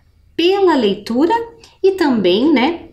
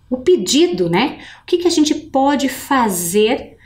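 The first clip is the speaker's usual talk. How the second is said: ordinary delivery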